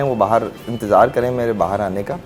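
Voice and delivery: usual speaking voice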